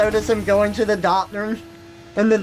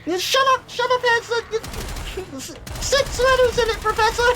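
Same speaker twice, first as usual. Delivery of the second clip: put-on much higher, fast know-it-all kid voice; obnoxious Luke. Luke lecturing the professor, high know-it-all whine. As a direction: rattle it off like an insufferable child. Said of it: "they make Luke just the most obnoxious thing".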